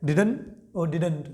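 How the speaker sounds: slow Didn't said as didn, then didn't with a clear final t; two pronunciations. Shown as a demonstration. Teacher repeats both ways of saying didn't for the class. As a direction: set the two forms of didn't side by side, slowly. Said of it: "दोनों तरीकों से आप इसको pronounce कर सकते हैं".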